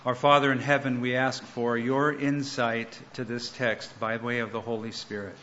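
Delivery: soft, reverent prayer voice. Real, not acted. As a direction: pray humbly, easing off at the end. Opening prayer before sermon.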